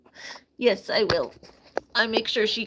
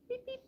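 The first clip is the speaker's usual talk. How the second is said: high, soft beep beep like a little machine; mock beeping; 14 semitones higher, 10 dB quieter than usual. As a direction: chirp a tiny electronic beep. Beeping at bird while bathing.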